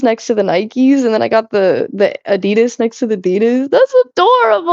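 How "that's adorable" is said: sudden high squeal of delight. Real, not acted. Streamer squealing at her shoe lineup, delighted. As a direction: gush over something cute, way up high.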